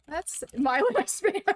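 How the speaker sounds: high, loud-ish laughing words. Real, not acted. Streamer cracks up mid-sentence, laughing. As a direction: crack up while speaking. Cracks up after keyboard smash.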